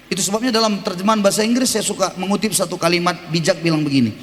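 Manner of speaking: ordinary talk, nothing marked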